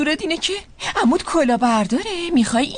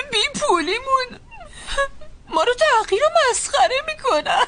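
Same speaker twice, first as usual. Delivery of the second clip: words broken by sobs, rising higher; crying; 8 semitones higher than usual. Acted. Mother cries to her daughter about being mocked, sobbing. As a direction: weep through the words, voice climbing.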